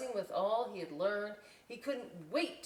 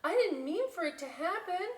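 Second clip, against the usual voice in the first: higher voiced little bat, sorry; a small bat apologizing in a raised voice; apologetic Little Bat; 11 semitones higher, 5 dB louder than usual. Little Bat apologizing for spilled juice, higher voice. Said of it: "Little Bat cried".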